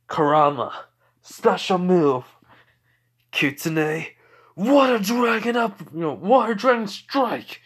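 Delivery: loud, high anime special-move cry; acted heroic battle shout; attack call. Calls out special attack in story.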